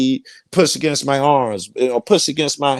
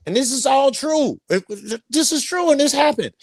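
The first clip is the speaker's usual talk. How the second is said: much higher, earnest insistence; insistent; 13 semitones higher than usual. Real, not acted. Storyteller insisting to the host it really happened, much higher. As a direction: swear it's all true.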